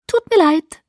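high, loud, breezy fake apology; unapologetic. A narrator's reading. Alice entschuldigt sich bei Bella ohne Reue. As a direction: say sorry without meaning it. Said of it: "ohne den leisesten Anflug von Reue".

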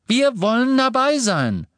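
very high, loud outburst; furious. A narrator's reading. Neville demands to join the fight, furious. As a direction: cry out in angry insistence. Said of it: "erwiderte Neville wütend".

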